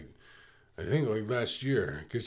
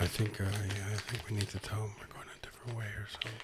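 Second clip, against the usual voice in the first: low, very soft, near-whispered confiding; hushed aside; 5 semitones lower, 12 dB quieter than usual. Pretend. Cohost confers behind another's back about firing him, hushed. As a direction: drop to a secretive undertone.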